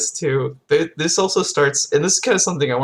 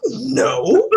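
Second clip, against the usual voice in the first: high-pitched, hammed-up indignant objection; mock offended; 10 semitones higher than usual. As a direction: object as if personally affronted, for laughs. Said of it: "like, offended".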